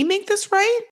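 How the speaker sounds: high, incredulous mimicry with rising end; mocking echo. Throws his question back scornfully.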